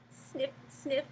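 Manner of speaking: soft sniffing snake; hissed sniff. Acted. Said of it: "hissed the snake".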